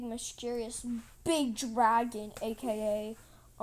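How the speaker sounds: plain reading voice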